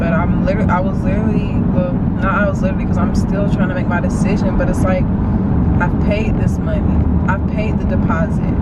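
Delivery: tearful, shaky worry in the car; talking through tears, doubting herself; crying on camera, overwhelmed; real crying. Real, not acted. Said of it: "y'all gonna see me crying".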